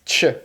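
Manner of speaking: "Tch" as T plus SH; a short isolated "Tch" consonant; tch sound. Shown as a demonstration. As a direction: say "Tch" as one joined sound. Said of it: "vai ter o som de".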